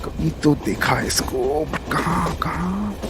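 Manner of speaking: soft puzzled murmur; murmur, straining to remember; muttering to himself. Acted. Inner thought, trying to recall.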